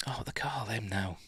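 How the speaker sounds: a breathy whisper to nobody; whispered aside. Real, not acted. Viewer recalls a word to himself, whispering. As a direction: whisper it to yourself, mid-thought. Muttering to self, recalling term.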